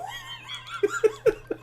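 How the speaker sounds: laugh. Real, not acted. Laughs at friend's story.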